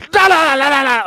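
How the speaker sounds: high, loud "da-da-da" barrage; aggressive mock yapping. Host mocking a woman on television, aggressive high yapping. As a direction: imitate an aggressive woman with loud nonsense syllables. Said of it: "she's so fucking aggressive".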